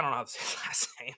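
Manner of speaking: breathy whisper with a laugh inside; sheepish whispered aside with a laugh. Shown as a demonstration. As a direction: whisper it like a secret. Repeats line, then whispers it.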